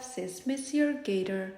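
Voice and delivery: plain reading voice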